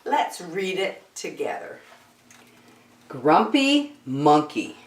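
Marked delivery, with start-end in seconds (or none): none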